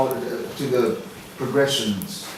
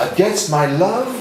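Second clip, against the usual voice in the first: driven, louder verse delivery; passionate demo; 3 semitones higher, 6 dB louder than usual. Shown as a demonstration. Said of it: "It's filled with passion".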